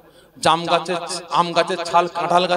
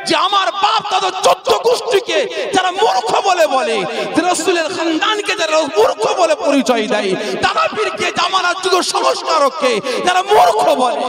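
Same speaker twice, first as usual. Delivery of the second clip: fiery preaching; 12 semitones higher than usual. Real, not acted. Preacher rousing the crowd in defence of the Prophet, fiery. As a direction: hammer home each phrase to the crowd.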